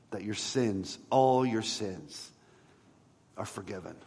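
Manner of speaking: usual speaking voice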